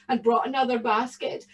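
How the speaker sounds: ordinary delivery, nothing marked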